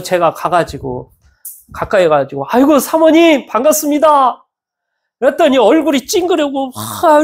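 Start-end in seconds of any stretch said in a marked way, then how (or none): 2.5-4.4 higher, hearty hello; glad greeting. Retold or imitated. Pastor cheering up a troubled pastor's wife, glad greeting. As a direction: greet her gladly, pitched up. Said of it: "반갑게 이렇게 맞이하고 이러면은".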